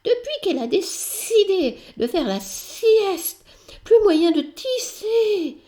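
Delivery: high, slow, whiny little voice; a small creature grumbling about the nap; slow squeaky grumble with a laugh; fussy spider complaining. Acted.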